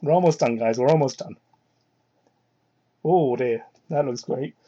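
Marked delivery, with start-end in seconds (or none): none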